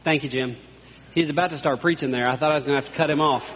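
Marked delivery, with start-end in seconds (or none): none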